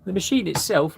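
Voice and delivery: ordinary delivery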